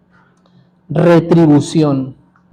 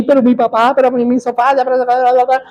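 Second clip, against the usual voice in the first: higher, run-on whining protest; mock protesting; 5 semitones higher, much faster than usual. Son still arguing with God about his missing father, mock protesting. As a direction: imitate a stubborn complaint blurring into blah-blah.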